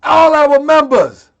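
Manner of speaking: All our members slow and loud; stressed all our members. Real, not acted. Insisting he told All our members, slow and loud. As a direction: press each word of All our members. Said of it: "repeated for emphasis".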